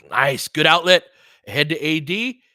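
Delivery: lively play-by-play shout; excited call. Real, not acted. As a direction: call the outlet with energy. Live call of Lakers break.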